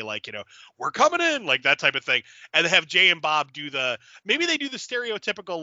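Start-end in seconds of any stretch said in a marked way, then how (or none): 0.8-1.4 high, called-out guard line; guard calling out. Retold or imitated. Security guards announce they are entering, mock call. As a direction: shout it like a guard outside the door. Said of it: "the security guards again say".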